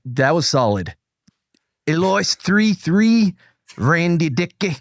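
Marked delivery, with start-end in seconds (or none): 1.9-4.8 slow, put-on Scottish accent; badly faked Scottish accent; attempted Scottish accent. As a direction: read names in a laboured fake Scottish. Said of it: "so Scottish accent?".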